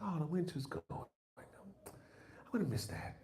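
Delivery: high, slow, whispered sigh; wistful whisper. Retold or imitated. Comic voicing sadness at winter ending, whispered. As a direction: breathe it out, sorry to see winter go. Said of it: "It's like".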